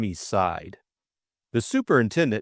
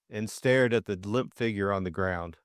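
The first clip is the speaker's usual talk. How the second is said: usual speaking voice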